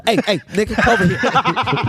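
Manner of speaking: laughing sharp call to Matt; snapping at a friend. Retold or imitated. Calling Matt away from the tables, snapping with a laugh. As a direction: hiss him back over, half laughing. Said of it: "I got to snap at him".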